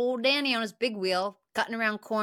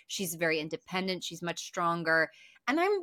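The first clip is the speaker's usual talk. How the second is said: normal talking voice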